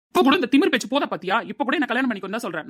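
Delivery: high, loud, indignant burst; heated, fast-building accusation; angry outburst. Acted. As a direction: fire back in outraged disbelief. Angry lovers' breakup quarrel.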